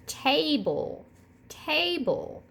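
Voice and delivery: table said slowly as ta-ble; syllable demo. Shown as a demonstration. Teacher sounding out table for third graders. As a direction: split table into two beats. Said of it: "put your hand under your chin and say".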